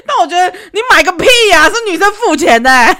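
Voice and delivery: loud, high, fast indignant laugh-rant; fast, loud, laughing indignation; exasperated outburst. Real, not acted. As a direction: blurt it out in disbelief.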